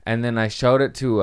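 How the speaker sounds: ordinary talk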